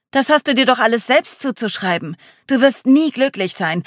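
berating, pitched well up; shouted inner critic. A narrator's reading. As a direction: call out cruel accusations loudly. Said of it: "Das ruft die Stimme mir zu".

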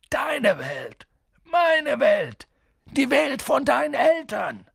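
building from a cry to a high shout; worked-up giant shouting; exasperated shouting. A narrator's reading.

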